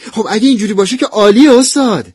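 high, loud delight; thrilled student, pitch leaping up; happy. Acted. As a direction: burst out overjoyed at the offer. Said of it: "با خوشحالی گفتم".

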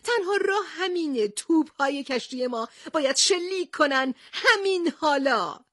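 high, agitated outcry; upset shouting. A narrator's reading. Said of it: "با ناراحتی فریاد زد". (با ناراحتی و صدای زیر فریاد زدن)

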